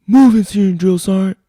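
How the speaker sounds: loud, very high military holler; recruit's shouted reply. Acted. Recruit answers senior drill sergeant's summons, hollering high and loud. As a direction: sing out the acknowledgment at full volume.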